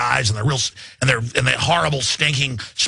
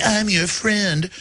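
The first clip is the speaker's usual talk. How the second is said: fake friendly; 3 semitones higher, much slower than usual. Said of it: "in their suit going".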